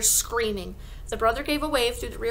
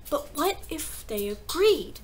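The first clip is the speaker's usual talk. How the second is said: high, drawn-out hopeful question; slow, pitched-up hope; eager cry; 5 semitones higher, slower than usual. A narrator's reading. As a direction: float the idea as an eager child. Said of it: "Matilda cried eagerly".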